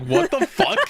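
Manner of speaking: high, laughing what the fuck; shocked outburst through laughter; startled. Retold or imitated. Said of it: "I was like".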